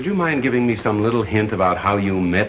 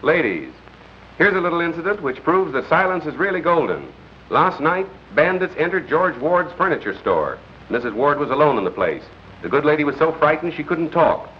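raised, lilting announcer pitch; radio news anecdote; 4 semitones higher than usual. Acted. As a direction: tell it as a punchy on-air anecdote. Reads radio news broadcast.

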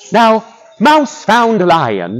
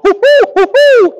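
high monkey hooting; monkey hoots; 5 dB louder, faster than usual. Acted. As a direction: hoot like a startled monkey. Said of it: "Monkey just went".

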